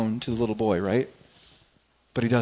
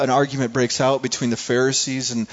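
ordinary delivery

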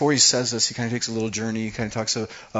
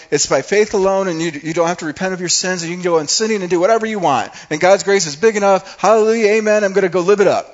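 mocking careless believers; 8 semitones higher, 10 dB louder than usual.

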